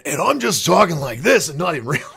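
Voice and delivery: high, loud radio-host impression; Rush Limbaugh. Shown as a demonstration. Host showing fans the voice he slips into, Limbaugh impression.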